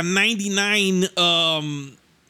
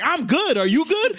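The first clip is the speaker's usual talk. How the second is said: high, rising challenge back; animated mock standoff; retold defensive comeback; 10 semitones higher than usual. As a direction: throw the question right back, pitched up.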